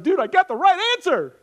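high, loud gleeful boasting; Peter, all excited. Retold or imitated. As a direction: crow it like a game-show winner. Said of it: "he's all excited".

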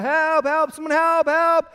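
high, loud cry for help; screaming for help. Acted. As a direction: act out a man yelling up from a cliff. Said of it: "he starts screaming out".

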